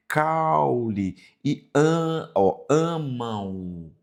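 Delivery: caule and amam drawn out slowly, the final M sounding like U; slow pronunciation demo. Shown as a demonstration. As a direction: stretch out amam so its ending glides to U. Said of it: "esse M ele traz um som de U".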